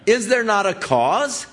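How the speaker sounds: high, put-on voice of a cocky kid; brash kid brother. Kid brother shrugging off his angry older brother, high and cocky. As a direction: toss it back like an innocent youngster. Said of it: "And David said".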